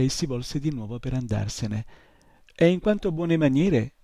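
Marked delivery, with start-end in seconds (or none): 2.5-3.9 grumbly scolding, higher and louder; grumbling. A narrator's reading. Old shopkeeper scolds the departing boy's manners, grumbling. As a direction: grumble a reproach with pointed emphasis. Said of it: "la voce brontolona".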